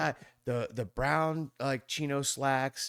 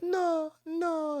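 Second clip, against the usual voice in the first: no, no in a much higher voice; high mock no. Mother refusing the stranger at her door: high, mock no. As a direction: mimic the wary mother, pitched way up.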